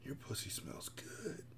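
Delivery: very soft, slow lover's whisper; hushed, drawn-out seductive aside; sensual whisper. Acted. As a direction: whisper it slowly and intimately. Lover murmuring during sex.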